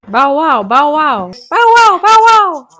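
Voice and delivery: loud, high yapping dog barks; barking dog. Pretend. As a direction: bark like a small excited dog. Girlfriend playing a barking dog.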